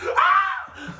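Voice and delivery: high, loud mock shriek; aunt's scream. Guest imitating Aunt Leanne screaming at the photo. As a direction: belt out a horrified shriek, half laughing. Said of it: "you just hear my Aunt Leanne just".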